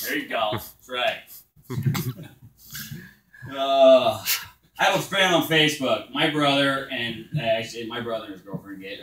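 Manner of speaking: usual speaking voice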